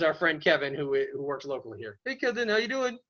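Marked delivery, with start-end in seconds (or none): 2.0-3.0 high-pitched puppet dog greeting; Blaze the Fire Dog. Pretend. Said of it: "This is Blaze the Fire Dog".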